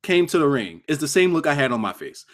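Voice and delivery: ordinary talk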